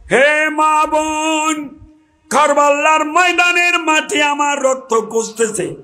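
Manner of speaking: long, high sustained preacher's cry; acted Imam's high, ringing appeal; calling out. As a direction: draw out the address, pitched well up.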